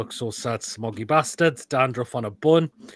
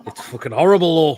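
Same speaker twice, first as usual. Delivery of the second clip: loud disgust; 4 semitones higher, 9 dB louder than usual. Real, not acted. Tasting raw onion.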